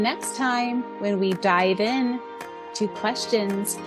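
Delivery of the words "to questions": ordinary talk, nothing marked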